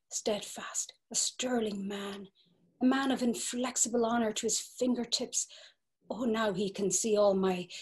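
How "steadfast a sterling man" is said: breathless whispered sigh. Acted. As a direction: whisper it as a secret thrill. Gertie's secret longing.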